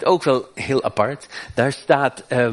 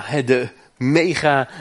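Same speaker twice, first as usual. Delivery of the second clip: ordinary delivery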